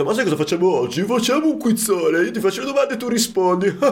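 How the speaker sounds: high, quick quoting voice; imitating his friend. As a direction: mimic a teasing friend. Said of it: "mi ha detto".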